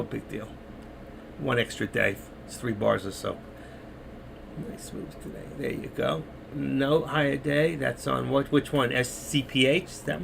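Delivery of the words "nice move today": whispered to himself; whispered aside. Real, not acted. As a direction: breathe it under your breath. Muttering to himself at the chart.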